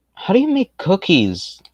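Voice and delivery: high surprised question. Real, not acted. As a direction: blurt it out, startled. Reading chat aloud in surprise.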